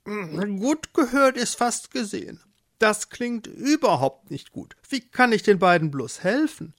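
high-pitched mole character; concerned mole. Acted. Mole overhears the couple's trouble, high concerned voice. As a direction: worry aloud as a little animal.

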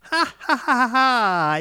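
high, loud mocking cackle; witch's cackle. A narrator's reading. Witch laughs at the lovers, high loud cackle. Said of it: "Die Hexe lachte".

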